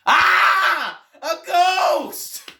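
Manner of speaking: high, loud, panicked yell of fright; frightened shout. Acted. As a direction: scream as if you've seen a ghost. Disciples frightened by ghost shape.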